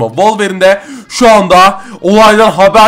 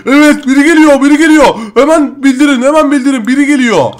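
higher-pitched henchman raising a hammed-up alarm; mock alarm; 6 semitones higher, 3 dB louder than usual. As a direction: warn the gang someone is coming, play-panicked. (yapmacık telaşla haber veren çete üyesi)

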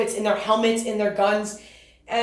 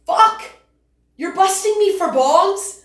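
much higher and louder, outraged disbelief; incredulous outburst; 7 semitones higher, 3 dB louder, slower than usual. Retold or imitated. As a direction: blow up, can't believe it. Outrage at being busted for bongs.

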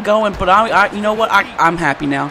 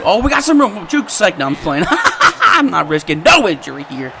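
usual speaking voice

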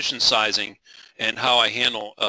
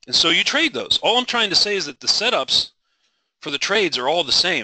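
passionate; 6 semitones higher, 4 dB louder than usual. Real, not acted. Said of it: "I'm a little passionate this morning".

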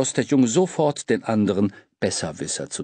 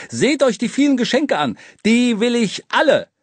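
gieriger Ausruf; 12 semitones higher, 5 dB louder than usual. A narrator's reading. Gieriger Besserwisser ruft.

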